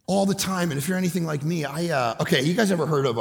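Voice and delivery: usual speaking voice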